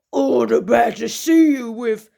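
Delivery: a growly-free, loud wolf reply. Acted.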